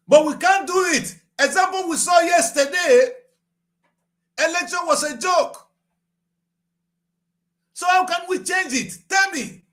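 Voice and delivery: loud, high, desperate outcry; real frustrated shouting; anguished shout. Real, not acted. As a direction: cry out in frustration to the listener. Despairing rant about elections.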